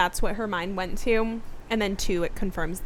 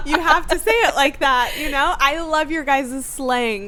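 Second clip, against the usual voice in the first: usual speaking voice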